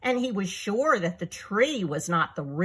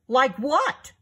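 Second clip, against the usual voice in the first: higher-pitched, puzzled question; confused question; 6 semitones higher, 3 dB louder than usual. A narrator's reading. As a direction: ask it as a baffled lion. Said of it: "asked the confused Lion".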